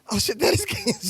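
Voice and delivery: high-pitched imitation of her surprise; mock surprised realization. Man retelling her spotting the actor, high mock surprise. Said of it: "She was like".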